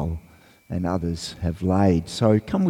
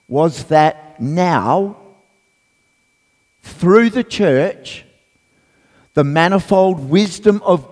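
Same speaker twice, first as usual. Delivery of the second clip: raised, emphatic public Bible reading; scripture reading; 6 semitones higher, 5 dB louder than usual. Real, not acted. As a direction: proclaim the passage to the whole congregation, pitched up. Reads scripture aloud to congregation.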